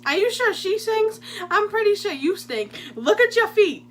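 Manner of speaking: high, laughing teasing voice; mocking counselor. Counselor teases Bob about his feet, laughing mock voice. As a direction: tease him through a laugh. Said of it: "he goes".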